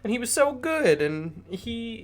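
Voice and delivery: put-on high, doting priestess gushing over the boy; gushing Ophelia. Ophelia pleads with the mom, high and gushing. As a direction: raise the pitch and gush with praise. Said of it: "but Ophelia's like".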